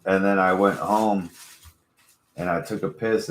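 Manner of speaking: normal talking voice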